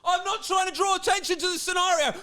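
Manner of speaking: high-pitched hammed-up shouting; sarcastic high mock wail; mock hollering. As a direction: holler it like an attention-seeker denying it. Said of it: "You're like".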